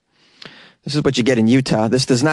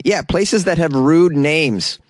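usual speaking voice

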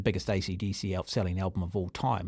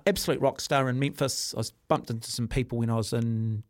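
ordinary talk, nothing marked

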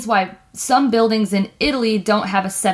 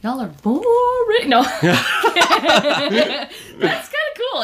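laughing; 6 semitones higher, much slower than usual. Real, not acted. Teasing co-host, laughing.

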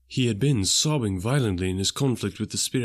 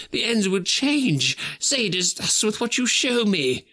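put-on higher, urgent old man reasoning; Scrooge, pleading; 11 semitones higher than usual. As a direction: bargain with fate, hoping. Scrooge argues with the spirit.

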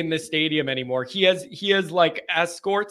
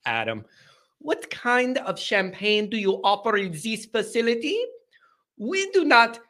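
posh mock accent; 4 semitones higher than usual. Host mocks rich caller with fancy accent. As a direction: ham up an unknown fancy accent. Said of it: "I don't know what accent that is".

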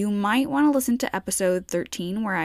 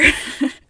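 bright laugh; 7 semitones higher, 6 dB louder than usual. Real, not acted. Laugh after joke.

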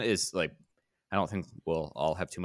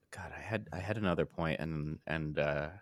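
trailing off; 2 semitones lower, 9 dB quieter than usual. Real, not acted. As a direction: voice fading away, much softer. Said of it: "I lost my train of thought".

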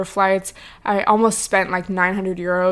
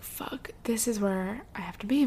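whispered curse under the breath; dismayed whisper; 11 dB quieter than usual. Retold or imitated. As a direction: mutter it like you just saw your doom. Seeing the longest line, dismay.

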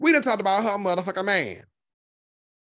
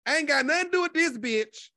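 ordinary talk, nothing marked

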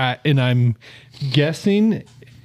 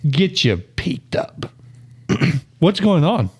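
losing voice; 3 semitones higher, 3 dB louder, slower than usual. Real, not acted. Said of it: "I'm losing my voice".

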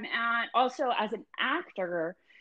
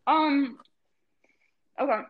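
chewing while talking; 3 semitones higher than usual. Real, not acted. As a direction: mouth full of food. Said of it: "I'm chewing 'cause I'm eating right now".

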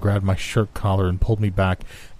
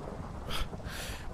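weary sigh; 15 dB quieter than usual. A narrator's reading. Wounded friend before speaking.

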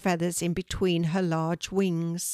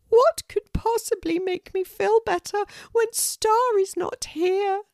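sad pelican Moon; 5 dB louder than usual. Acted. Moon despairs without Star.

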